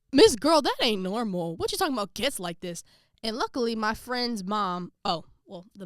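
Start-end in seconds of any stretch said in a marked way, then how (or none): 0.1-2.8 put-on a loud, high, indignant "Miss Girl"; sassy callout. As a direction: call her out, half-joking but offended. Calling out the coach's wife.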